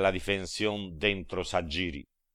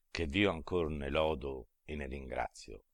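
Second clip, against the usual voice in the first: plain reading voice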